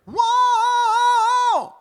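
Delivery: very high, loud nasal Whoa!; middle resonance. Shown as a demonstration. Coach belts a nasal middle-voice Whoa!. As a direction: shoot it through the nose in middle voice. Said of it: "me using middle resonance".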